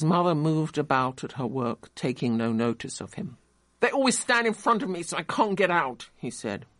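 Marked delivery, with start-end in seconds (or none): 3.8-6.1 put-on sulky boy. A narrator's reading. Boy complains.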